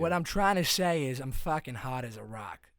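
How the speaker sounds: loud Boston bro; Massachusetts accent. Acted. Masshole host hyped about podcasting, loud Boston accent. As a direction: insist on it in broad Boston. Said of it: "I just mastered the guy's accent".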